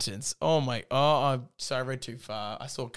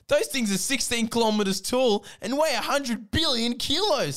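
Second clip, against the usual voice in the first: voiced Sam; 9 semitones higher, 3 dB louder than usual. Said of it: "said Sam".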